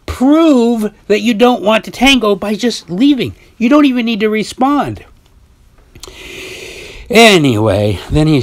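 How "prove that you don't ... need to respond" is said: high exasperated quote. Retold or imitated. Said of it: "it's like".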